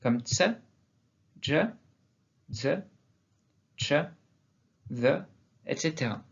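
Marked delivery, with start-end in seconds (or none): 0.2-5.2 isolated consonants "ts", "dj", "z", "ch", "the"; variant pronunciations. Shown as a demonstration. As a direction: demonstrate each sound separately with a short vowel. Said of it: "nombreuses prononciations de cette lettre".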